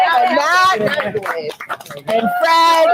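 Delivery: high bursting laugh on the name. Real, not acted. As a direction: laugh out as you say it. Naming team, laughing.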